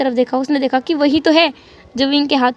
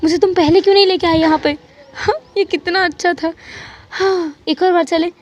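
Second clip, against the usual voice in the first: laughing excitedly; 4 semitones higher than usual. A narrator's reading. Wang Ji to Wing after the boat ride, giddy and excited. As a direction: gush like a kid who just loved a ride. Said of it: "वांग जी ने हंसते हुए कहा".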